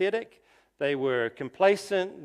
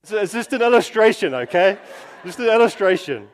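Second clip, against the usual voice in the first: louder, fast, laughing it off; laughing reassurance; 5 semitones higher, 10 dB louder, faster than usual. Real, not acted. Preacher laughing off the wife example to the congregation, loudly. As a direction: chuckle the disclaimer away quickly. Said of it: "Oh, not Serena, by the way".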